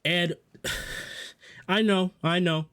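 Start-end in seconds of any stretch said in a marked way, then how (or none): 0.6-1.3 sigh. Real, not acted. Sighs before the next turn.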